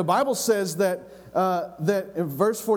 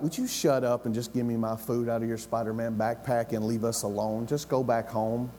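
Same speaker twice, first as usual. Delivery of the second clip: dismissive brother; 7 semitones lower, 4 dB quieter than usual. Acted. Older brother brushes off young David.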